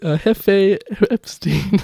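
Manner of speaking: high, slow put-on Mexican accent; mock Mexican accent. Joking about a villain in a slow mock Mexican accent. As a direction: drag out the words in a hammy Mexican accent. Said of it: "I would say Jefe".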